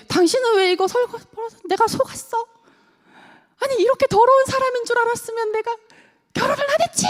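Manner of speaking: hammed-up rant at a messy husband; nagging spouse. Wife berating her husband in a high hammed-up scolding rant.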